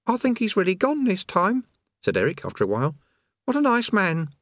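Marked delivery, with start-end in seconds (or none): 0.1-1.7 put-on high young boy's voice; lightly high-pitched youth voice; boy Eric. Eric's voice in audiobook. 3.4-4.3 put-on high young boy's voice; lightly high-pitched youth voice; boy Eric. Eric's voice.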